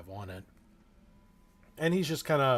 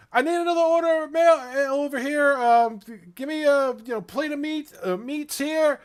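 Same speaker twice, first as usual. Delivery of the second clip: high, loud shouted order; loud order call; 13 dB louder than usual. Acted. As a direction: call it across the busy tavern. Waitress calling out orders.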